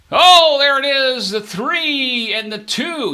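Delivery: loud, high, slow dealer call of the roll; stretched, excited table call; stickman call. Acted. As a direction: belt the numbers out like a stickman.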